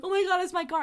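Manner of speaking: sudden high, relieved gasp of surprise. Retold or imitated. Finding her lost car after an hour, high relieved surprise. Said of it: "I'm like".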